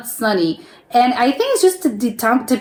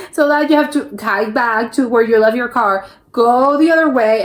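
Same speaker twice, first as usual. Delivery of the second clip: ordinary talk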